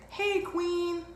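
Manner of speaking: high-pitched imitated holler; mock catcall. Some guy hailing her as she scoots past, imitated catcall.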